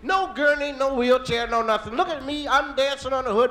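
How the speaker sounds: ordinary talk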